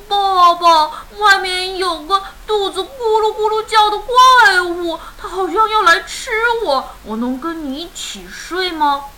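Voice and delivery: put-on high, whiny child voice; scared second cub. Second son fears being eaten by a monster, high whimpering plea.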